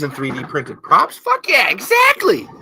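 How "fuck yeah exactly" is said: excited. Real, not acted. Excited agreement with chat.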